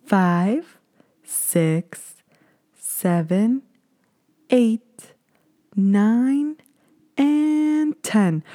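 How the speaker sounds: drawn-out count with long gaps; slow count. Retold or imitated. Mocking the nurse's slow count to ten during the swab. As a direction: count painfully slowly, dragging it out. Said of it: "she starts counting to ten like this".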